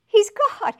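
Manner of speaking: He's God shouted high and loud; loud proclamation. Shown as a demonstration. Preacher to congregation, booming He's God. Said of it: "He's God (first softer take)".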